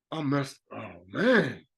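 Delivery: acting out a dawning realisation; stunned by revelation; sudden epiphany. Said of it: "you get an epiphany".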